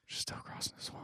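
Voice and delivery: whispered, breathless repeat; droning on about the long swamp scene, whispered. Shown as a demonstration. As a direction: whisper it for comic effect. Repeated joke, now whispered.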